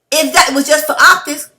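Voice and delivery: rising heated challenge; getting mad. Real, not acted. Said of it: "See, I'm getting mad".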